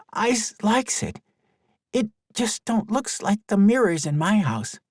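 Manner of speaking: put-on a kid trying to be kind; child voice. Boy reassures girl.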